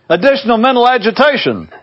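high-pitched, energetic answer; yelled back. A narrator's reading. Lad answering his father's pop quiz, yelling back. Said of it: "lad yelled back".

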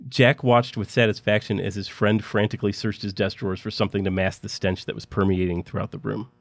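plain reading voice

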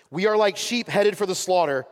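normal talking voice

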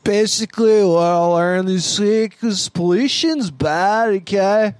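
put-on slow, high slurred drunk talk. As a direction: run the words together like a drunk. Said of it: "That's the drunk voice".

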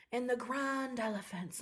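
hushed English bedtime-story narrator; English lady. Retold or imitated. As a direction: imitate a soft English lady reading at bedtime. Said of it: "this English lady, and she's like".

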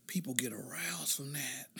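breathy joking whisper; hushed mock ASMR whisper.

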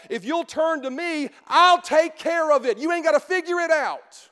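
ordinary talk

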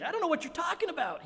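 panicky denial. Retold or imitated. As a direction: squeak it out in a rush. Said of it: "he says".

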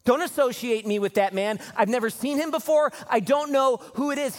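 voicing Peter denying Jesus; frantic denial. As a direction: push him away in a hurry.

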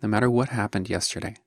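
calm meditation guide, long pauses between short phrases; guided meditation. Acted. Teacher guiding listeners through a morning meditation, calm and spacious. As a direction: lead them gently, leaving space after each phrase.

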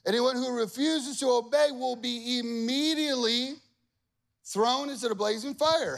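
high, proclaiming royal herald voice; king's decree. Acted. Preacher voicing the king's decree, proclaiming. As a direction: announce it like a herald.

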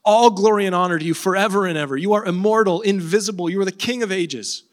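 acting out Paul bursting into worship; overflowing praise. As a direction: pour it out, unable to hold back the glory. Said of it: "he can't not give glory to God".

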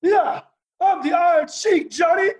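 high shouting Iron Sheik; Iron Sheik impression. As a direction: boom it out like the champ hyping himself. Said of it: "And Sheik goes".

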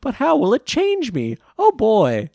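put-on high, loud gee-whiz kid voice; wholesome 1950s kid. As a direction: squeak it out like a Peanuts kid. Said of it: "He's like".

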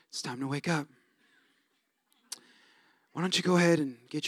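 soft, hushed, tender morning voice, ending in a whisper; idealized gentle parent. Pretend. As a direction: coo it like the perfect patient dad. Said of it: "to have our kids go to school like this".

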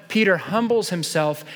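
ordinary delivery

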